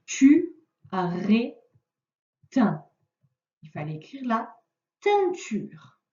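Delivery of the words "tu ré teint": tu, ré, teint as three separate beats; syllable by syllable. Shown as a demonstration. Teacher reading jumbled syllables to the pupil, one by one. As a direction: split tu, ré, teint into syllables with pauses.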